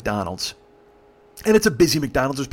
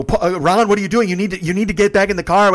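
loud, higher voice of an appalled friend; raised-voice retold reprimand; exasperated scolding; 6 semitones higher, 5 dB louder than usual. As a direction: act out telling him off. Girls scold Ron outside.

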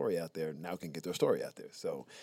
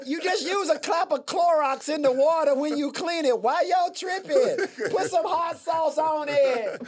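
mock defender; 8 dB louder than usual. Mock chitlins defense, high.

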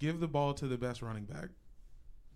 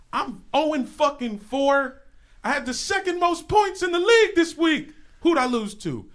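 loud, high-pitched outraged ranting; worked-up, passionate yelling; fiery rant; 10 semitones higher, 9 dB louder than usual. Real, not acted. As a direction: rage about a galling defeat at full volume. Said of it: "Fiery, passionate beginning to the show".

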